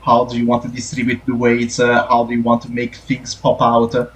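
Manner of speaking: ordinary talk, nothing marked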